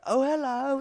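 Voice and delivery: very high, loud English greeting; mock English accent. Buddy teasing an English comic, mock English accent. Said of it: "he has clearly an accent".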